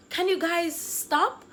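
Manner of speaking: high, drawn-out plea to stop; exasperated begging. Retold or imitated. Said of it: "breathless begging everybody".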